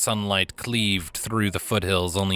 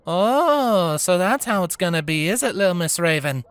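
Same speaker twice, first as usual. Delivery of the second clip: coy teasing; 8 semitones higher than usual. Acted. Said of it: "she asked coyly".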